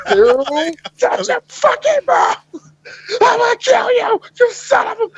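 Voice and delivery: very high, strained threat; hammed-up frantic movie rage, nearly crying; Tom Cruise. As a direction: imitate a hysterical action hero counting down. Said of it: "Tom Cruise was doing his best Tom Cruise".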